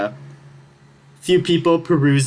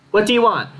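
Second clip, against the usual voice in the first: higher, birdlike mimic voice; high kenku voice; 10 semitones higher than usual. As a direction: squawk it like a parroting bird. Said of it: "you hear a noise go".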